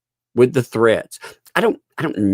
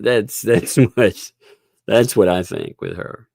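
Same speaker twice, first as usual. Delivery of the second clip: ordinary talk, nothing marked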